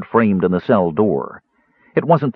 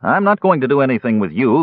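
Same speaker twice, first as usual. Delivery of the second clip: scornful, raised retort; sarcastic; 7 semitones higher, 4 dB louder than usual. A narrator's reading. Bearskin man dismissing the Kender, sarcastic. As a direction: brush off the pest with sarcasm. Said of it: "the bearskin man said sarcastically".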